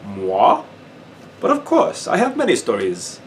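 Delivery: lightly voiced sarcastic Frenchman; a hint of a theatrical French soldier; sarcastic Frenchman playing innocent; mock French surprise.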